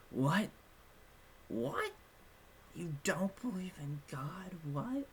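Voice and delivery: rising, shocked mock disbelief with a whispered middle; retold freak-out. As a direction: imitate a classmate panicking over the news. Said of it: "She's freaking out".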